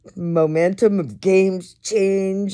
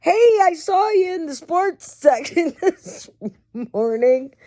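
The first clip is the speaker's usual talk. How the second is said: much higher, teasing coworker greeting; high mocking coworker voice; 11 semitones higher than usual. Coworker teasing him about the sports section, high mocking voice. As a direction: squeak it out like a coworker ribbing you. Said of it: "So I get to work in the morning going".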